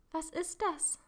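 put-on girl startled by a noise in a haunted house, mildly scared; frightened. A narrator's reading. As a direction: ask with mild alarm. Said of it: "fragte sie ängstlich".

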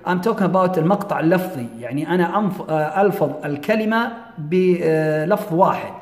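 usual speaking voice

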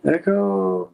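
high, slow echoing repeats; cave echo. Pretend. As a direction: let each echo fade like a cave. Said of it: "sounds like you're in a cave just saying echo".

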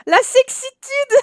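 high, loud, drawn-out and giggly; squealed joke dissolving into laughter; laughing mock admiration. Real, not acted. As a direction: sing it out high, then crack up. Joking about character walking in underwear.

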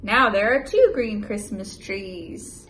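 lively, loud picture-book read-aloud; animated storybook voice for a little one; reading to a child. Acted. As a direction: read it big and bouncy for a toddler.

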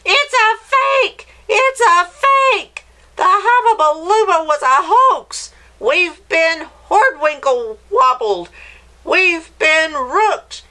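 high, loud outraged crowd yelling; angry crowd shouting. Acted. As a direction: shout it out as a furious mob who's been cheated. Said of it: "and shouted".

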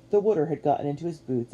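ordinary delivery, nothing marked

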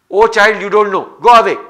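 put-on an irritated mother brushing off her child. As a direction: shoo the child away, annoyed.

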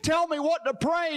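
patient in tears begging the doctor for a prayer; tearful pleading. Retold or imitated. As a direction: plead like a man who is weeping. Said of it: "the guy had tears rolling out of his eyes".

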